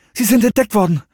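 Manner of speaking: high, urgent report; hurried and pitched high; hasty explanation. A narrator's reading. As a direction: blurt out the bad news. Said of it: "erklärte Serenity ihr hastig".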